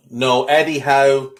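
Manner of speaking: ordinary delivery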